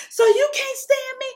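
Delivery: high, loud, hurt confrontation; furious outburst. Retold or imitated. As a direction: hurl it at him in anger. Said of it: "And I remember saying".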